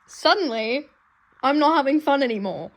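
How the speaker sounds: loud upset protest; upset outburst. Real, not acted. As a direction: blurt it out in dismay.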